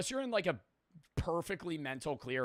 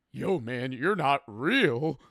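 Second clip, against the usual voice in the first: slow, louder, eerie voice of the friend; imitating a friend lost in his trip; spooked tripping friend; 6 dB louder, much slower than usual. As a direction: drag out the strange line like you are far gone.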